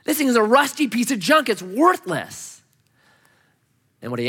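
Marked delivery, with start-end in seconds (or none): 0.0-2.6 high, drawn-out disbelief; acted-out incredulous outburst. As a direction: protest it in amazement, stretching the last word. Acting the bike owner's disbelief.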